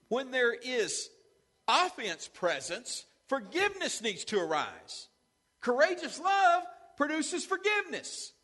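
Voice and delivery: slow and loud, rising to a full high shout on "Courageous love"; building preaching shout. Real, not acted. Pastor preaching forgiveness to the congregation, climbing to a full shout. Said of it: "I'm preaching a lot better".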